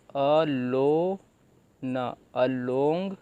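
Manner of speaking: slow syllable-by-syllable reading of अलोंग; sounding out syllables. Shown as a demonstration. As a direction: stretch out अ लो, then न, अलोंग. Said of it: "यहाँ पे जो ओ की मात्रा है ये ल में जुड़ गई".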